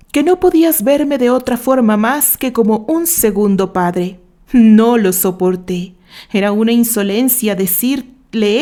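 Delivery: George's voice rising into loud, bitter anguish; anguished confession. Acted. George confessing his crimes to Candy, loud and anguished. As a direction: spit out the heartbreak, loud and wounded.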